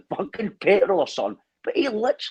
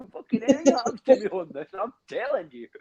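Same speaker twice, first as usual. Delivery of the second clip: higher-pitched, laughing through the words; laughing retold warning; 8 semitones higher than usual. Telling friends about the terrifying ride queue, laughing retold warning.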